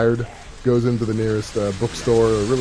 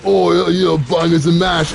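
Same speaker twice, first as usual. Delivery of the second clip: mock British; 5 semitones higher, 5 dB louder than usual. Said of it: "they're just kind of like".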